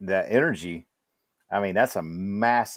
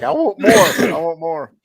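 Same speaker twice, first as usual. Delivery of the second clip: high, loud, drawn-out plea; whiny, stretched-out demand for more; mock craving; 7 semitones higher, 7 dB louder, slower than usual. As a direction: beg for more like a hooked reader. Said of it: "I was like".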